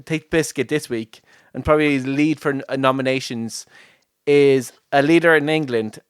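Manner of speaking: normal talking voice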